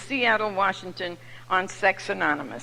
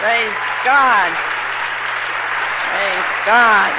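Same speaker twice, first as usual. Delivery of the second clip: exultant praise; 6 dB louder, much slower than usual. Real, not acted. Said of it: "testimony praise".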